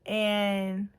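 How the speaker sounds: ordinary talk, nothing marked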